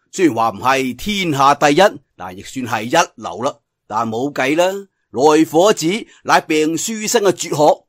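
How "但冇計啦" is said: ordinary delivery, nothing marked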